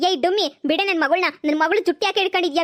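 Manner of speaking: ordinary talk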